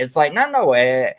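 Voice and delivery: usual speaking voice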